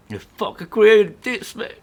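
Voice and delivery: emotional outburst. Real, not acted. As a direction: burst out loudly, overcome with emotion. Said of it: "getting emotional in front of people".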